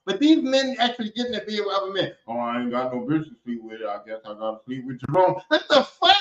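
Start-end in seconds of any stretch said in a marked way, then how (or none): 2.3-5.3 low, lazy mock voice of a guy on the show; mock dumb guy voice. Mocking men on the show, low and dopey. 5.5-6.2 disbelief. Real, not acted. Reacting in disbelief.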